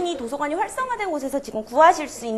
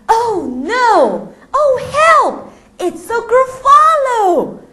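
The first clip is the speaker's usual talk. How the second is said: very high, loud scared cry; terrified mouse; 12 semitones higher, 8 dB louder, much slower than usual. Acted. The mouse meeting the real Gruffalo, terrified. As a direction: cry out in fright.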